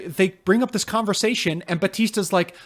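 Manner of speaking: normal talking voice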